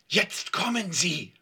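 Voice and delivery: slow, tense stage hiss; urgent hiss. A narrator's reading. Yvonne warns as the garage opens, urgent hiss. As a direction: hiss a warning to accomplices. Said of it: "zischte Yvonne".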